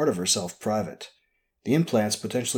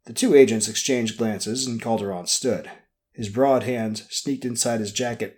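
ordinary delivery

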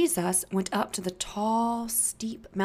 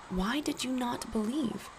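soft gentle rebuke; 4 semitones higher, 6 dB quieter than usual. Acted. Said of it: "Jesus says".

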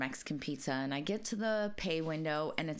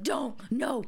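louder, higher imagined protest; flipping out; 6 semitones higher, 5 dB louder than usual. Pretend. Imagining being there to stop a dare, flipping out. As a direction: act out frantically trying to stop them. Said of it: "flipping out".

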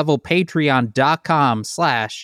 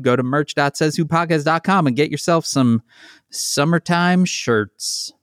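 ordinary talk

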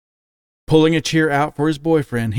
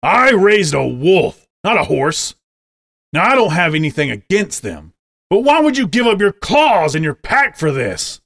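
disappointed outrage; 5 semitones higher, 4 dB louder than usual. A narrator's reading. Wolf father scolding son for becoming a horse, loud. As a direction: pour out years of disappointment. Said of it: "glared at him with clear disappointment".

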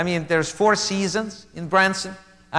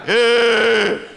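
nervous laugh; 3 semitones higher, 4 dB louder than usual. Shown as a demonstration. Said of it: "loud and obnoxious".

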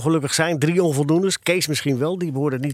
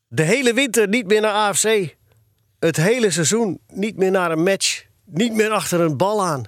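father's ban in a raised voice; higher-pitched, emphatic parental decree; stern threat; 5 semitones higher than usual. A narrator's reading. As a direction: pile up the dreaded prohibitions. Imagining father's dreaded ban.

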